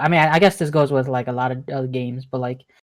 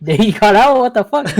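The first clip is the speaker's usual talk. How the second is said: confused outburst; 6 semitones higher, 7 dB louder than usual. Retold or imitated. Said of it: "And it's like".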